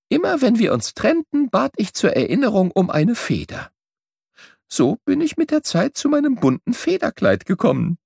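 bright, lively voice of a small bird telling his adventures; the travelling bird. A narrator's reading. Travelling bird tells Merle his journey, lively and bright. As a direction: tell it like a proud little bird who saw the world.

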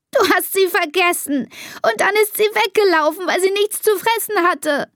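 put-on high, tearful child's wail; sobbing accusation. A narrator's reading. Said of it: "schluchzt Elisa".

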